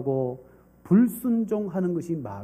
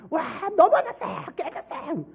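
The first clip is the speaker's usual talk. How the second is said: put-on excited children; 11 semitones higher than usual. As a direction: squeal like thrilled kids at story time. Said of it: "아이들은".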